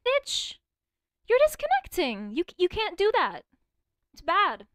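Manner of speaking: high, loud outraged shouting; furious yell. Real, not acted. Streamer yells at a friend who is disconnecting, furious. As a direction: scream at the game for disconnecting.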